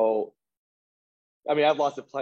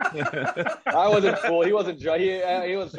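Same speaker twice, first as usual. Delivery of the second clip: laughing; 7 semitones higher, 4 dB louder, slower than usual. Real, not acted. Laughing while stressing disbelief.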